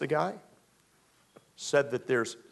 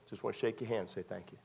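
grateful thanks; 5 semitones lower, 11 dB quieter, faster than usual. Acted. Grateful man thanking the prophet.

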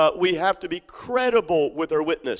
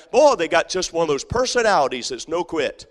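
ordinary talk

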